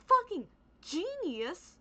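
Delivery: normal talking voice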